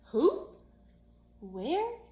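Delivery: sudden high startled "Who? Where?"; surprised question. Acted. Child startled by the teacher's odd comparison, surprised. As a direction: blurt it out, caught off guard. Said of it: "I said".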